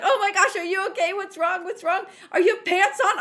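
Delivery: a hint of alarmed coworker; worried coworker. Coworker hearing screams, mildly alarmed. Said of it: "she's like".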